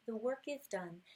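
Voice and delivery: ordinary delivery, nothing marked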